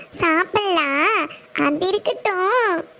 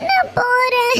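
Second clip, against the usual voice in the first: crying; 4 semitones higher, slower than usual. Acted. Girl sobbing that she is leaving, tearful. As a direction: whimper நான் போறேன் as if about to cry. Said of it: "இப்படி அழுகுற".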